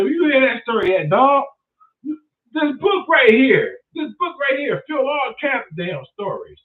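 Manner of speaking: high-pitched, loud mock excitement; animato. Acting out an intrigued listener's exchange.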